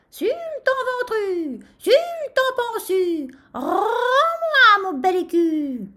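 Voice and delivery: high, drawn-out rooster crow; crowing at full throat. A narrator's reading. Rooster taunts the sultan for his coin, crowing. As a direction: crow each phrase long and loud, stretching the vowels. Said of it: "et s'égosilla".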